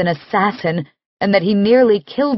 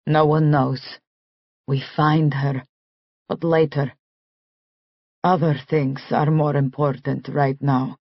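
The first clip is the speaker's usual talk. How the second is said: put-on Russian accent; 6 semitones lower, 5 dB quieter than usual. Nick speaks in Russian accent.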